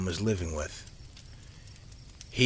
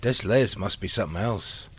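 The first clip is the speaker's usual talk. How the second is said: ordinary delivery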